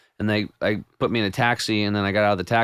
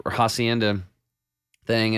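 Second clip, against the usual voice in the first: usual speaking voice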